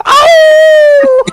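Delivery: high, loud wolf howl; imitated howl. Friend retelling the client's howl as the fish lands, loud howl. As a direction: howl at full force. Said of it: "he's like".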